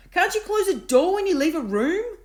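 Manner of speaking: loud, high scolding yell; angry yell. A narrator's reading. Davina yelling at Bart about the door. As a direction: tell him off down the corridor.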